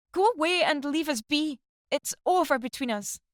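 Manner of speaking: wife screaming that the marriage is over. A narrator's reading. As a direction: hurl every word at him, high. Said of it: "she was screaming at him".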